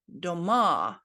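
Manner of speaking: "doma" stressed on the final A; short first syllable, loud long "ma" in "doma"; stress on last syllable. Shown as a demonstration. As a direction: push the last vowel of "doma". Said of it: "emphasis on the last syllable".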